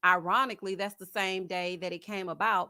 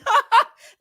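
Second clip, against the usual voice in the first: high, loud cackling laugh; loud cackle; 14 dB louder than usual. Real, not acted. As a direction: cackle hard. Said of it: "the way that I cackle".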